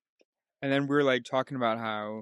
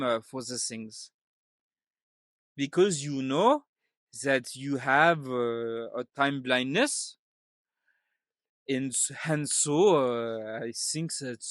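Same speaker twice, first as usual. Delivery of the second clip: halting, put-on French accent. Dad telling his wife to be on time, French accent. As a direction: feel your way through it in a mock French accent. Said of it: "if I wanna do French".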